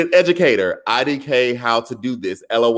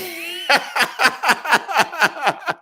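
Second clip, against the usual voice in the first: high, delighted laugh at the chat; bursting into real laughter; big laugh; 13 semitones higher than usual. Real, not acted. Laughing at the chat's jokes, a high delighted laugh.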